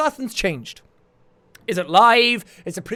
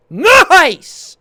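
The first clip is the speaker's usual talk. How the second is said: excited nice; 6 semitones higher, 7 dB louder than usual. Real, not acted. Delighted at finding the sound.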